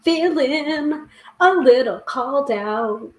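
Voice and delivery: drawn out slowly, a little sheepish; slow, wry admission; slow rueful drawl. Real, not acted. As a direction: stretch Feeling a little called out slowly, like you've just been caught. Reacting to the card's message.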